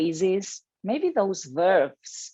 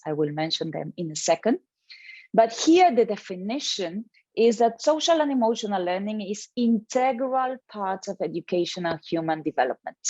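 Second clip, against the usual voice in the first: ordinary talk, nothing marked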